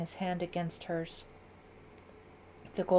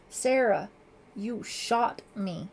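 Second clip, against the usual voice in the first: slow, stunned statement; pained declaration; 3 semitones higher, 5 dB louder, slower than usual. A narrator's reading. Wounded father accuses daughter, slow stunned declaration. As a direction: state it slowly in disbelief. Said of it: "Lieutenant Wilkins declared".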